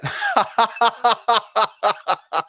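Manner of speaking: sudden high laugh breaking into the sentence; real laugh that cuts off the sentence; burst of laughter. Real, not acted. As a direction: crack up mid-sentence, caught off guard. Caught off guard mid-sentence.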